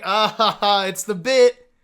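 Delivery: loud, forced ha-ha laugh; mock nervous laugh-off. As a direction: imitate laughing it off, loudly. Acts out laughing off awkward bit.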